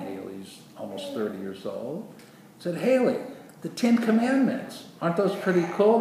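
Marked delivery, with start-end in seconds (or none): 2.8-6.0 much higher, eager question; bubbly mock-excited asking; acted enthusiasm. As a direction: pitch it up like sharing a wonder. Acts out enthusiastically asking Haley.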